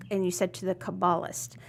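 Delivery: usual speaking voice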